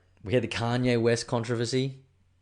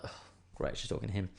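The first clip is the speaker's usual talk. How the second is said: unvoiced whisper to himself; whispered aside; 2 semitones lower, 9 dB quieter than usual. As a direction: breathe it as a private thought. Aside thought in the shop.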